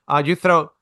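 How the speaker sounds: ordinary delivery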